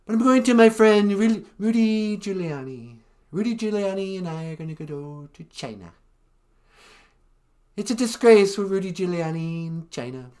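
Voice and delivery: lightly voiced Trump, sing-song rising and falling; cocky, condescending Trump; a touch of Trump swagger; Trump impression. Shown as a demonstration. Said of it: "when I do Trump".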